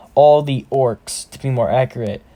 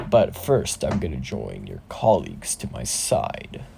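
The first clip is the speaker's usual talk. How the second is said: very low, hushed, menacing monarch; deep, quiet threat from a shadow ruler; alarming dark tone; 11 semitones lower, 5 dB quieter than usual. Acted. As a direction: intone it darkly, far below your usual pitch. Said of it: "in a very alarming and dark tone".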